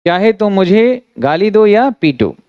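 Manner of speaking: ordinary delivery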